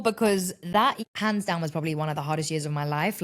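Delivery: ordinary talk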